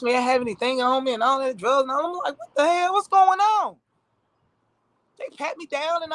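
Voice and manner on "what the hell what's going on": high-pitched, baffled outburst; alarmed disbelief. Retold or imitated. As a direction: voice climbing in retold confusion. Retelling being searched at airport.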